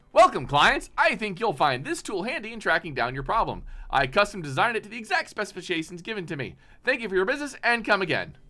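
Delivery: loud, high-pitched, upbeat salesman patter; cheery shop owner. Acted. Faisal's recorded message greeting his clients on the drone's screen, cheery. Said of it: "Very cheery".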